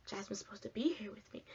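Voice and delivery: hushed, unvoiced worry; panicked whisper. Panicking alone in tent.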